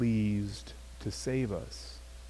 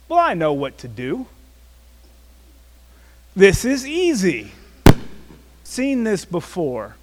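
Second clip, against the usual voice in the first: higher, louder, smugly sure of himself; mock overconfident inner voice; cocky; 9 semitones higher, 12 dB louder than usual. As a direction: brag to yourself like it's already done. Cocky thinking before second encounter.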